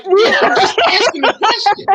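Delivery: husband laughing off the question; laughing reassurance. Retold or imitated. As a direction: insist innocently through a laugh. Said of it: "I'm like".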